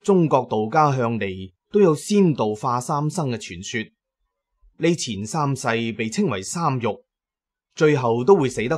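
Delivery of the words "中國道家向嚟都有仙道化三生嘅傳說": ordinary talk, nothing marked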